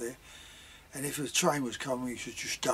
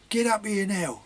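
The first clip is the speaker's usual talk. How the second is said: scolding mum; 7 semitones higher, 7 dB louder than usual. Retold or imitated. Mum ordering children up, retold.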